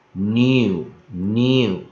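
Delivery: "new" drawn out slowly, three separate times; pronunciation demo. Shown as a demonstration. Teacher shows how N E U is read, pronunciation demo. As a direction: stretch "new" into a long nyoo. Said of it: "N E U都会念new".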